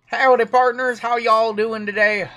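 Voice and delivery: hammed-up cowboy greeting. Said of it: "I'll rock the cowboy hat".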